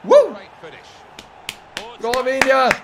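high, loud cheers to the players; excited shouting. Real, not acted. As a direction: yell names as the attack builds. Cheering players in attack on goal.

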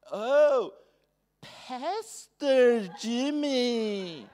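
a host caught off guard by the guest; surprised greeting. Retold or imitated.

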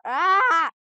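sudden loud high yelp; startled yelp. Real, not acted. Player yelping when a creature appears, startled. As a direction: jump at the fright with a yelp.

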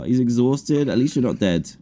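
normal talking voice

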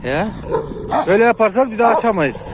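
loud, high furious yell at the dog; real angry shout; angry scolding. Real, not acted. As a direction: tell the dog off at full volume. Scolding dog mid-fight.

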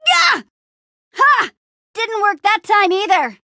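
high, effortful fighting cries; battle taunting; combat shouts. Acted. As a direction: taunt mid-swing, high and loud.

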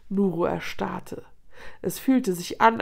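ordinary delivery

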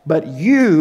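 weight on "you" in "But you"; emphatic you. Retold or imitated. Pastor voicing Jesus to his disciples, stress on "you". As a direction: hit "you" hard and then pause. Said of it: "that emphasis on the you".

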